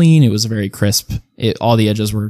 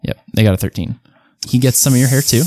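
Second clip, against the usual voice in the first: usual speaking voice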